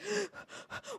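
sharp high intake of breath, acted out; retold gasp. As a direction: gasp like you just got jumped. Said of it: "And I was like".

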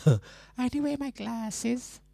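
very high, soft put-on voice; Drea. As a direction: mimic a friend in a tiny high-pitched voice. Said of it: "when I do my Dre impersonation".

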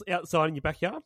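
ordinary delivery

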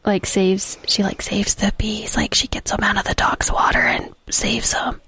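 hushed guilty whisper. Real, not acted. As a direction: whisper so nobody overhears. Hiding dead bee from daughter.

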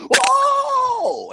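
high, loud drawn-out whoa; the whole crowd gasping in awe; crowd amazement. Retold or imitated. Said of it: "force them to go".

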